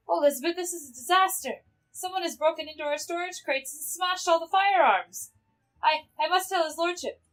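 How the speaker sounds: high, flustered game-character voice; acted panic. Reporting smashed firearms to Lisbeth, in acted panic.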